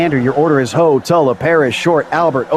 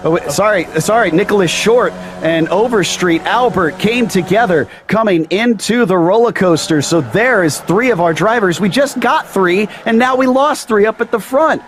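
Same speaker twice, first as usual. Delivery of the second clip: excited commentator; 7 semitones higher than usual. Real, not acted. Live race commentary, crash at front.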